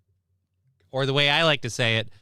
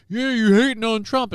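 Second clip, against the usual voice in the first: higher, louder mocking quote; higher, louder mock of a Trump fan's accusation; mocking Trump fans; 9 semitones higher, 4 dB louder than usual. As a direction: toss off a Trump fan's jab in a raised voice. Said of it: "the people that like him are just like".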